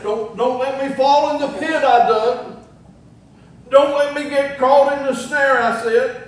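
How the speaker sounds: hammed-up desperate prayer; mock pleading prayer. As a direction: act out a sinner pleading for rescue. Acts out a sinner's pleading prayer.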